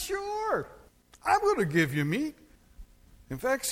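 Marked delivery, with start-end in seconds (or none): 0.0-2.4 high, louder sarcastic promise; God's sarcastic reply. Retold or imitated. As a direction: voice God granting it with irony. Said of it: "So God says".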